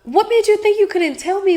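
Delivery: be the mom who can't believe you hid it; imitating hurt mom. Daughter imitating mom asking why she kept it secret, high and pleading. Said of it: "but she was just like".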